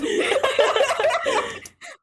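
long high giggle; a real giggle fit; high giggling laugh. Real, not acted. Giggling at the adverb mix-up with her co-host.

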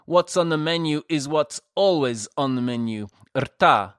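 lightly voiced giant waiter; hearty waiter. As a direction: boom it out like a proud host. Giant waiter answers guests.

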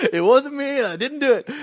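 high, loud imitated denial; mock protesting kid. Junior high boy denying blame to the leader, imitated protest. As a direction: plead innocence in a high kid's voice.

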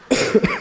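a sick rasping cough from strep throat; real cough. Real, not acted. Preacher with strep throat coughing mid-sermon. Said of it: "I do have strep throat".

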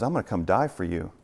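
low, soft and tender; a soft, tender Jesus voice. Pretend. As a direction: speak gently, like a promise of love. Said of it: "he still says".

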